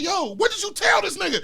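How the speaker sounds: high-pitched indignant demand; high, agitated questioning; retold freak-out. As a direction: act out exasperated disbelief, voice up high.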